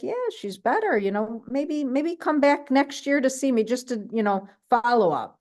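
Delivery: imitating the doctor's offhand reassurance; a hint of a chirpy doctor; breezy doctor. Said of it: "Doctor Kareem's like".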